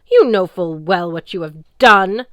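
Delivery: loud, commanding sea goddess voice; imperious goddess. Acted. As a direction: speak down to a mere mortal.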